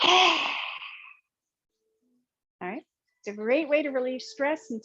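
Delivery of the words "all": forceful open-mouth breath out; lion's breath exhale. Shown as a demonstration. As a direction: push the breath out like a lion. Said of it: "So it looks like this".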